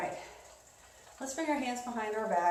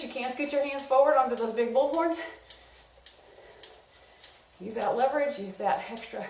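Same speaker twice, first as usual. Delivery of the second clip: cycling hard; 3 semitones higher than usual. Real, not acted. Coaching hard hill climb.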